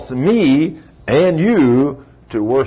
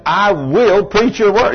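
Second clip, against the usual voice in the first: loud, high, slow promise; acted urgent pledge; desperate vow; 7 semitones higher, 5 dB louder, slower than usual. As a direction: hammer out each word as a pleading vow. Dying man repents, promises God.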